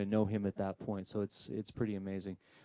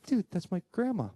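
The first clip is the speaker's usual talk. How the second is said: higher, pleading insist; insistent excitement; 9 semitones higher, 3 dB louder than usual. Retold or imitated. Attendee insists to the medium it is grandma, high excited plea. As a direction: push back, thrilled and stubborn.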